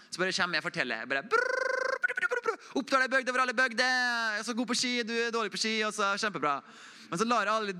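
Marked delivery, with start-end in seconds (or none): 1.3-2.7 high, rapid brrr babble like nonstop talking; mock chatter noise. Mimicking a friend who talks and never listens, high babble. 2.8-6.6 high, hammed-up bragging voice; boastful friend. Preacher mimics a self-absorbed friend, hammed-up high bragging.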